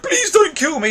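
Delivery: high-pitched, lightly hammed-up begging; mock pleading. Reviewer mimicking the misremembered Zod begging Superman, mock pleading. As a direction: beg in a mocking high voice. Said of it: "and Zod was like".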